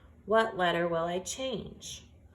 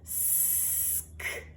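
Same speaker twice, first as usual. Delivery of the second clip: whispered slowly; 11 dB quieter than usual. Shown as a demonstration. Teacher whispers the word slowly to hear its sounds. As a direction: whisper Scat, stretching it out. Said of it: "I want to say it slowly".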